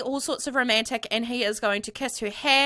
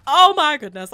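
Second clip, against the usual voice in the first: normal talking voice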